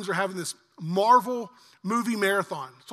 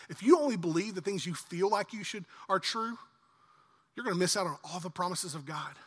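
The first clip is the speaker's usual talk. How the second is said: usual speaking voice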